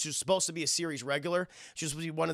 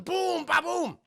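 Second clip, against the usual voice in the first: much higher, shouted boom; mouth explosion sound; 11 semitones higher, much slower than usual. Retold or imitated. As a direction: blast the sound effect out. Imitating explosion noise.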